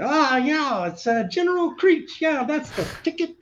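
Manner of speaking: very high, loud hammed-up liar voice; posing as General Creech in the SNL liar voice; SNL pathological liar. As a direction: oversell the lie, smug and pleased with yourself. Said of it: "pathological lawyer from Saturday Night Live".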